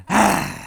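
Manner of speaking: villain's ugly grunt; a short ugly growl; mock grimace grunt. Villain turning to his homies, mock grunt. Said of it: "was like".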